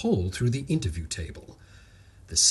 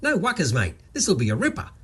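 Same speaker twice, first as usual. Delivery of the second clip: enthusiastic; 6 semitones higher, faster than usual. Acted. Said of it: "shook his hand enthusiastically".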